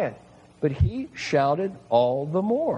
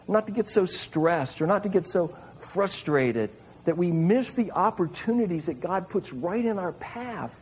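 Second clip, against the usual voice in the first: ordinary talk, nothing marked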